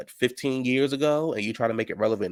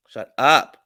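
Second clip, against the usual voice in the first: louder, punchier dismissal; emphatic repeat; 2 semitones higher, 7 dB louder than usual. Real, not acted. Recapper telling Mia off, louder emphatic shut up. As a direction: slam it shut, louder the second time. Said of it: "so shut up".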